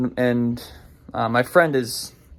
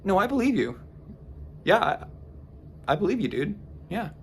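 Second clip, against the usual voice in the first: placating; 6 semitones higher, 8 dB quieter than usual. Retold or imitated. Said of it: "I'm like".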